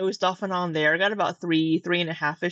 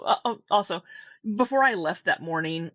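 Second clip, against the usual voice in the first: ordinary talk, nothing marked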